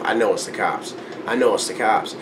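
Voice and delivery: low, hushed self-talk; muttering to oneself. Retold or imitated. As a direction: drop right down, as if only to yourself. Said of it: "and I just tell myself".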